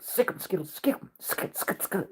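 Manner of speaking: hushed egging on of the dog; soft hissed command; urging whisper. Retold or imitated. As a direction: whisper sick him to the dog. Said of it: "like that".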